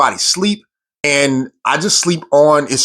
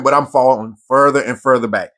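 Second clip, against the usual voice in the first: normal talking voice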